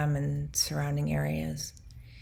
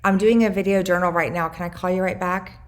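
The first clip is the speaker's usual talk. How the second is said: louder, higher, brisk phone greeting; answering a call; 3 semitones higher, 7 dB louder than usual. Real, not acted. Woman taking a friend's call mid-journal, louder and higher. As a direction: break off brightly to tell a caller you're busy.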